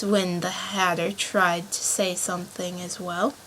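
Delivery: ordinary delivery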